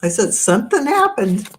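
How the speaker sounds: emphatic repeat. Real, not acted. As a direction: say it up and out so it gets heard.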